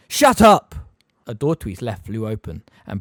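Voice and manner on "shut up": loud, high furious yell. A narrator's reading. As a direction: bark it out. Harry yelling at Peeves.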